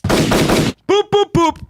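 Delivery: high rapid boops; vocal horn volley. Pretend. Hype man salutes the show, high horn volley. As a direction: fire off horn noises in threes. Imitating horn to greet a viewer.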